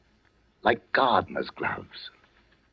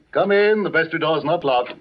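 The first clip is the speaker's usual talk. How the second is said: calling out; 6 semitones higher than usual. Acted. Calling to visitor at door.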